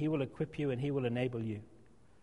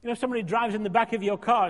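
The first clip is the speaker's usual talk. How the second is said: ordinary talk, nothing marked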